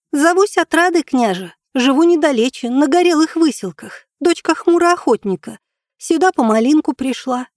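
put-on high, steady child's voice; little girl voice. A narrator's reading. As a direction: answer boldly like a young girl.

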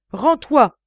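loud, high barked order to surrender; shouted order. A narrator's reading. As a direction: yell at the armed man to give up. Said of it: "lui cria Gévrol".

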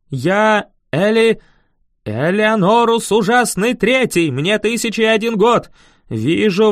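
loud, high, grandiose necromancer; exclaimed villain act. A narrator's reading. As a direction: proclaim yourself a dread dark lord. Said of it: "воскликнул".

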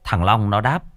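usual speaking voice

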